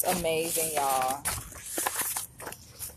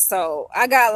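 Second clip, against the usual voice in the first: usual speaking voice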